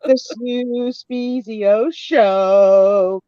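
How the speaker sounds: drawn-out, high sing-song show announcement; show jingle announcer. Acted. Host unveils the show's new logo, grand drawn-out announcer. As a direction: stretch it out big like a theme jingle.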